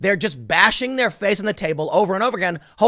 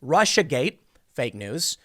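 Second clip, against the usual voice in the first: ordinary talk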